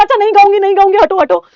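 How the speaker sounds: high, loud frightened pleading; high, loud scared shout; panicked plea. Retold or imitated. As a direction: beg her off in a panic. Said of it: "मैंने कहा".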